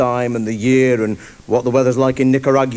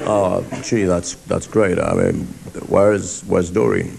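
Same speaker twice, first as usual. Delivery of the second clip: lower, put-out smooth talker; lightly voiced smoothie; 8 semitones lower, slower than usual. Cowboy thrown by the girl's answer, lightly lowered smooth voice. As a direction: stall like a deflated charmer. Chat-up man replies to the girl.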